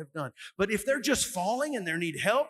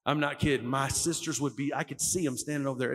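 ordinary talk

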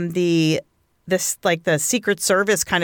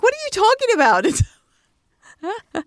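very high, drawn-out incredulity; mock bafflement; 14 semitones higher, much slower than usual. Clueless woman answering her disturbed friend, incredulous. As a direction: squeal the question slowly, utterly clueless.

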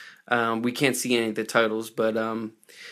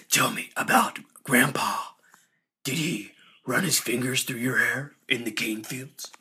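mock menacing vigilante impression; Batman; 3 dB quieter than usual. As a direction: imitate a dark vigilante questioning someone, with long pauses. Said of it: "he became Batman all of a sudden".

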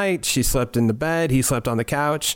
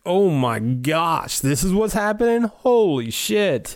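drawn-out, high-pitched amazement; jaw-dropped, voice soaring; acted astonishment; 5 semitones higher, slower than usual. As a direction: stretch the words in stunned disbelief. Host acts out jaw-dropped amazement.

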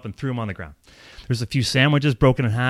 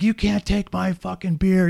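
mock screaming; 7 semitones higher, slower than usual. Drunk man defying police over his beer, mock screaming. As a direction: imitate a ranting man in a stretched, raised pitch. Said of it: "He's screaming".

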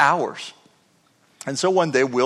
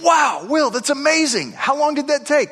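higher, amazed questions; mock amazement, pitched up; 11 semitones higher than usual. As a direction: retell your astonishment, lifted. Retold amazed questions to Will.

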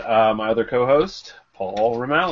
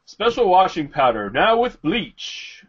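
higher, louder, slow ad pitch; hard sell ad; 7 semitones higher, 4 dB louder, slower than usual. Acted. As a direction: sell the powder like a 1950s pitchman. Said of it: "You sound like a commercial for a nineteen fifties, soap of some sort".

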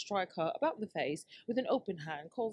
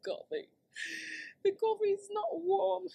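high whiny complaint. Real, not acted. Complaining about cold coffee.

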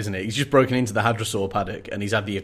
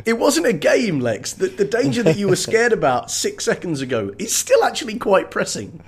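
raised-pitch mock telling-off; exasperated scolding; 8 semitones higher than usual. Podcaster scolds Lex about the danger, exasperated. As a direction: scold a child in disbelief.

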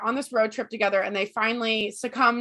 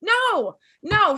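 high, loud indignant refusal; angry no; 8 semitones higher, 4 dB louder than usual. Real, not acted. Fan protesting the writer's choice, angry. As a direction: reject it flatly, outraged.

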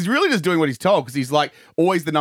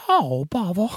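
higher, put-on storybook bear; Winnie the Pooh; 4 semitones higher, slower than usual. Cartoon bear leaving the scare floor, put-on voice. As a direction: lament lightly as a cartoon bear. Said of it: "my friend Winnie".

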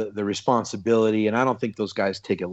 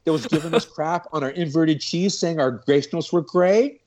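higher, indignant mock questioning; incredulous challenge; 7 semitones higher than usual. Retold challenge to the judge who criticized them, incredulous. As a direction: call him out in disbelief, pitched up.

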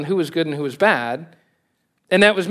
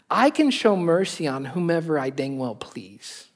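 Texan paraphrase; 4 dB quieter than usual. Pretend. Pastor gives his own Texan paraphrase of God, light Texan. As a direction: say it like a lightly Texan God, easy and matter-of-fact. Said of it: "That's Texan version of that".

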